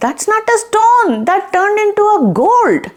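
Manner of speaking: put-on very high, eager kid voice; excited children. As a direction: call mother over like amazed children. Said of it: "The kids look and say".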